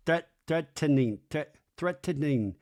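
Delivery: threatening said as threat-ten-ning, split into separate syllables; mimicked threatening, broken after threat with every syllable sounded; Michelle's THREATENING. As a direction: imitate "threatening" with an extra syllable, restarting on threat. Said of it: "the way she says threatening".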